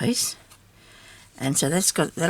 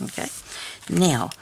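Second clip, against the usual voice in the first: ordinary talk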